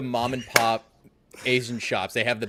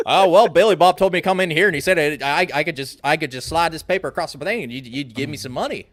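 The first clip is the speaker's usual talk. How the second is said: hillbilly voice; 5 semitones higher, 5 dB louder than usual. Retold or imitated. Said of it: "looking like the hillbilly".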